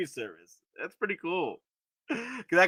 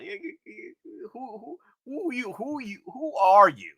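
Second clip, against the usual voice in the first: mock stammering challenge; 7 semitones higher than usual.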